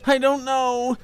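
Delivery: high, loud creature voice calling back; high goblin voice. Acted. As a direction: shout it back in a small creature's voice. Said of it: "he called back".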